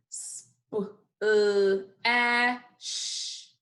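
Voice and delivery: SPLASH broken into separate sounds, sp, uh, a, sh; segmented phonics sounds, sp... uh... a... sh; sounded out by phonemes. Shown as a demonstration. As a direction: say each sound of splash alone with gaps. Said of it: "One more time".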